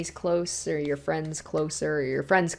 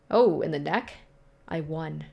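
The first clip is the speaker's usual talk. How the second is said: ordinary delivery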